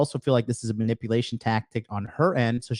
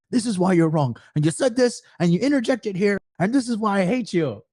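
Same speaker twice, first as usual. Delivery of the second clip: high, loud rapid lawyer rant; coked-out attorney; 9 semitones higher, 4 dB louder than usual. Retold or imitated. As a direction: rattle it off like a wired lawyer.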